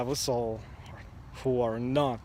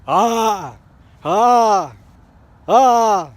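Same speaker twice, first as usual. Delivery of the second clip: loud high scream, ah ah ah; demo scream; 7 semitones higher, 13 dB louder, much slower than usual. Shown as a demonstration. Scaring off charging dogs with loud high screams. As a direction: bellow each ah to scare off a dog. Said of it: "as I demonstrated".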